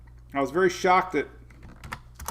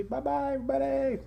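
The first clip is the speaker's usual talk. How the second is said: much higher, soft cutesy dog voice; dog saying bye; 10 semitones higher, 6 dB quieter than usual. Pretend. Owner voicing the dog waving goodbye to viewers, high and soft. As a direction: squeak it out sweetly like the little dog. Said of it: "You say".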